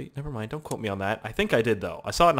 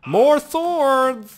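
high, loud drawn-out cry; battle yell; 12 semitones higher, 8 dB louder than usual. Real, not acted. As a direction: holler it like a war cry. Calls out gleefully during attack.

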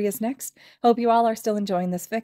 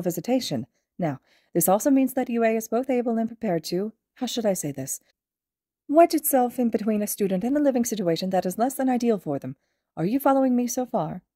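higher, polite small-principal voice; a courteous, gently cheerful headmaster; Nezu's voice; 4 semitones higher than usual. Acted. Principal welcoming a student, higher and polite. Nezu explains guardianship.

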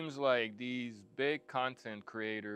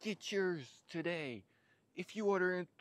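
high, upbeat sales pitch; infomercial pitch; 4 semitones higher than usual. Pretend. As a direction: hype it like a late night ad. Mock infomercial pitch opening.

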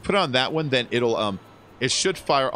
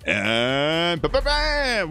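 slow, stretched announcer flourish; drawn-out ba-ba-bam; 5 semitones higher, much slower than usual. Real, not acted. Streamer hyping up a new donation, drawn-out fanfare. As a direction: drag out the fanfare like a game-show host.

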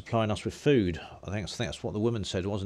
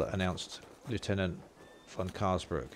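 ordinary talk, nothing marked

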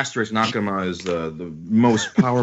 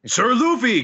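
high-pitched acted hail; mock shout; 13 semitones higher, 3 dB louder than usual. Samurai hailing Luffy from the boat, mock shout. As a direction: call out high, without full volume. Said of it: "Kinemon shouts".

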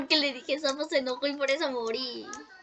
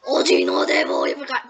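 much lower, loud mock threat; deep mock Mom voice; 7 dB louder than usual. Gamer recalls fighting Mom, booms a deep mock threat. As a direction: boom it out like a cartoon mom boss. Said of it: "cuando me enfrenté a Mom".

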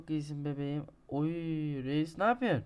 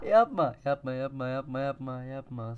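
fading plea; 5 dB louder than usual. Real, not acted. Pleading fades after scream.

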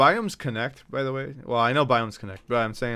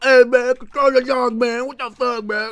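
high, loud mock-angry stranger; mad crackhead impression; 12 semitones higher, 10 dB louder than usual. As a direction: imitate an agitated guy yelling about the dog. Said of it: "he started getting all mad".

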